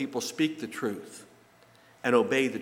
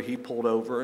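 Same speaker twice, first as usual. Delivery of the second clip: usual speaking voice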